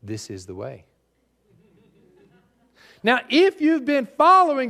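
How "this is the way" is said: low, quiet and level, no inflection; flat cold conviction, dropping low and soft; hard, cold determination. Retold or imitated. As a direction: Mandalorian creed, low and quiet. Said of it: "his mentor said these words".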